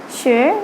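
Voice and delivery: sh rising; second tone. Shown as a demonstration. Teacher recapping the second tone. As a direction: pull sh upward. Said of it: "the second".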